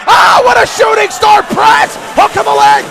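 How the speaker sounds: high, loud thrilled call; excited shout. Real, not acted. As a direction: yell the big move like it just happened. Climactic move in live commentary.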